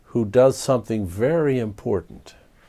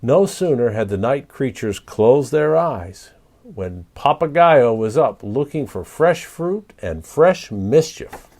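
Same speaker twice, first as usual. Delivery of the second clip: ordinary delivery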